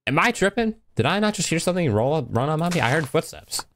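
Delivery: high-pitched, startled questioning; alarmed disbelief, voice jumping up. Real, not acted. Startled by footsteps in game.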